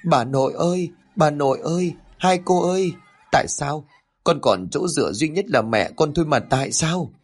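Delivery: high, tearful wailing; a boy crying for his dead mother; sobbing child. A narrator's reading. Orphaned boy crying over his mother's corpse, sobbing. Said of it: "tiếng khóc nấc nghẹn ngào".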